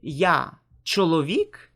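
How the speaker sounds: slow question, "чоловік" lifted into a query. Shown as a demonstration. Teacher showing learners how speech makes a question, questioning tone. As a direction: ask "Я чоловік?" slowly, as a model question. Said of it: "you just make a questioning tone".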